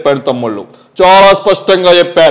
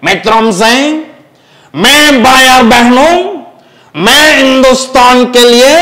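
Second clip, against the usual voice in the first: higher, louder, slow rally speech; mimicked politician; 5 semitones higher, 3 dB louder, slower than usual. Mimic addresses the nation in a higher, louder, slow rally voice. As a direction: orate like a national leader addressing brothers and sisters.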